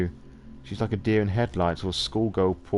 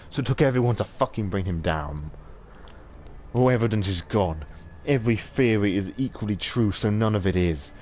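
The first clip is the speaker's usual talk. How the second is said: ordinary talk, nothing marked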